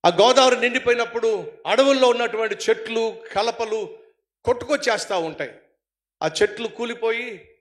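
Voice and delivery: ordinary talk, nothing marked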